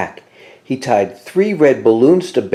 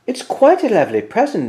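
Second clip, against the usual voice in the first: Mouse voice; 10 semitones higher, faster than usual. A narrator's reading. Mouse sadly leaving.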